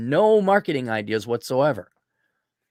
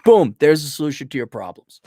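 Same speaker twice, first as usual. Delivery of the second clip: ordinary talk, nothing marked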